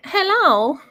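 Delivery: high, loud squeaky hello; fridge voice. Pretend. Co-host voicing her humming refrigerator saying hello. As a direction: give the fridge a chirpy little voice. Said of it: "the refrigerator just like".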